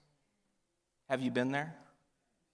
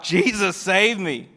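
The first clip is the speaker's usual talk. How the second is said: loud, higher desperate cry; 6 semitones higher, 7 dB louder, slower than usual. Acted. Sinking Peter cries to Jesus for help, loud higher cry. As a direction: yell for rescue as you sink. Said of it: "He cries out".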